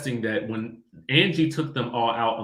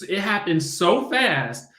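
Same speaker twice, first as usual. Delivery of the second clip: usual speaking voice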